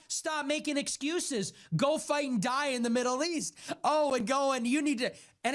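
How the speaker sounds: hammed-up scolding of weak men, mostly high; mock preachy lecture. Mocking society scolding young men, high sarcastic sneer. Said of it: "So we're telling young men".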